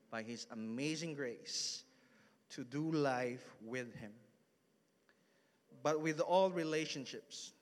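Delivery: usual speaking voice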